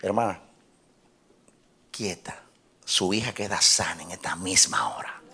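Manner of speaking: soft, near-whispered pronouncement; hushed faith declaration. Retold or imitated. Pastor telling a weeping mother her daughter is healed, hushed. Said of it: "le digo así".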